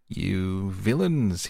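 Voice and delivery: drawn-out, indignant cry; furious storybook yell; outraged shout. A narrator's reading. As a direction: shout it slowly with a big swoop on the insult. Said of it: "he shouted".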